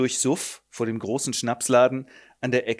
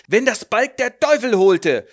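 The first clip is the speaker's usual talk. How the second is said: loud high cursing; a drunk father raging; hateful curse; 8 semitones higher, 8 dB louder than usual. Acted. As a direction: curse the child.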